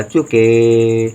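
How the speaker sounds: very slow, stretched out; drawn out syllables. Shown as a demonstration. Teacher dictating a Hindi word to pupils, drawn out slowly. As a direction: sound it out slowly for the class. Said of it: "teacher sounds out word".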